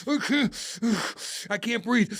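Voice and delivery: panicked gasping. Retold or imitated. Panicking while jaw wired shut.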